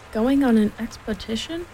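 ordinary delivery

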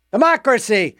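ordinary talk, nothing marked

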